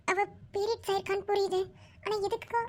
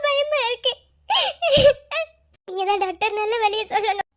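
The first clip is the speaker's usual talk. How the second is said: high, loud panicked pleading with a sob in it; frightened pleading; 5 semitones higher, 8 dB louder than usual. Acted. Frightened patient begging the doctor for help, high and loud. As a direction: beg the doctor, voice rising in fear.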